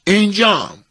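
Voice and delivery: high, loud answer to roll call. Acted. Old townsman answering the official's check, calling out. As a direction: sing out that you are present.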